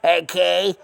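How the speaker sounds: ordinary talk, nothing marked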